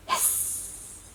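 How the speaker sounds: whispered hiss of steam. Acted. Dragon hitting the water, hissing steam.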